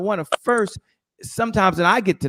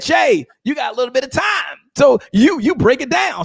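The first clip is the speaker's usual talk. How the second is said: mock hype call; 9 semitones higher than usual. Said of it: "they said".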